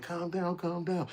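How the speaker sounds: very high mock soothing; high-pitched imitation of people soothing; calming her. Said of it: "They was like".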